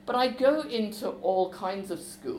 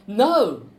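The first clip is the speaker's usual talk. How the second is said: a sharp loud yelled no, pitched up; 5 semitones higher, 12 dB louder than usual. Acted. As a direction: shout him down, loud and high. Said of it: "Samir turns and yells at me".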